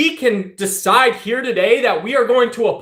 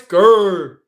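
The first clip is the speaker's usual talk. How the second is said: short mock growl, low and a bit louder; 3 semitones lower, 4 dB louder than usual. Streamer answering chat with a mock growl. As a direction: growl it back at chat like a joke bass voice. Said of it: "You need bass in your voice?".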